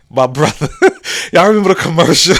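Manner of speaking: words breaking into real laughter; trying not to laugh. Real, not acted. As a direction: fight the giggles while telling it. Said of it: "I'm trying not to laugh".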